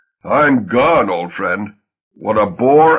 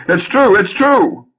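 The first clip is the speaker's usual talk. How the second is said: loud, much higher wail of shame; loudly abject; 9 semitones higher, 4 dB louder, slower than usual. Acted. Husband confessing to his wife, loud abject wail. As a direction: beg for pity at full volume. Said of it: "Felix, loudly abject".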